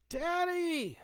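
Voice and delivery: put-on long, high child's yell. A narrator's reading. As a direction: daughters crying out for their father. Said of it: "they yelled".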